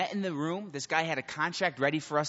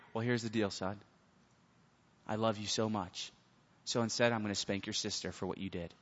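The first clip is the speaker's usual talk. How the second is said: a hint of a calm, lower father; softer, lower dad laying out the deal; lightly voiced dad; 5 semitones lower, 6 dB quieter than usual. Dad speaks to son.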